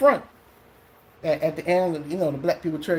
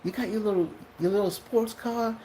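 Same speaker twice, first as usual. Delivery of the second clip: higher, quieter mocking preacher; a lightly mocking pastor's jab; imitating the pastor; 5 semitones higher, 4 dB quieter than usual. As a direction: tease him about his sports car from the pulpit. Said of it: "He said".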